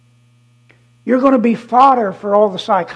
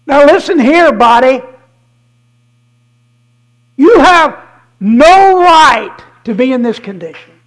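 loud, firm scolding of his own body; commanding rebuke; 7 dB louder than usual. Retold or imitated. Preacher rebuking his body, loud and commanding. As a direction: order the illness out loudly.

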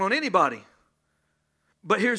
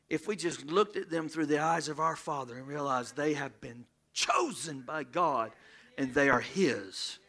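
lower, softer, intimate; soft, tender preaching; 6 semitones lower, 6 dB quieter than usual. Real, not acted. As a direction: draw them in gently.

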